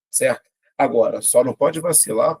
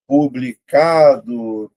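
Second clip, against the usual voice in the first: "Publicado" slowed, CA louder and held; syllable by syllable; 5 dB louder, much slower than usual. Shown as a demonstration. Teacher checking where the stress falls, syllable by syllable. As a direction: stretch "Publicado" and hit CA. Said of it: "Vou separar".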